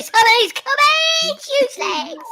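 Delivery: high, loud Cockney host voice; Cockney geezer. Acted. Geezer host moves on to comments, high and loud. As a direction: call it out as a high-pitched Cockney character.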